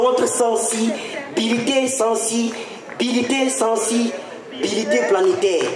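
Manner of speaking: sensibilité drawn out slowly, repeated; slow repeated stress. Acted. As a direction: stretch each sensibilité slowly. Slam poet repeats word slowly.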